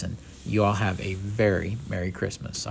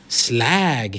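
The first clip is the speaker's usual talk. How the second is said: acted bully's sneer; scornful jeer; 3 semitones higher, 5 dB louder than usual. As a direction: spit out a one-word insult with contempt. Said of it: "he sneered".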